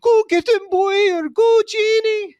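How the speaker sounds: high, loud mock-Scottish woman's screech; screaming Scottish accent. Old woman cheering on the Genie from the audience, screaming in Scottish accent. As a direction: shriek it like a Scottish matron, very high-pitched. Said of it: "she's screaming in the Scottish accent".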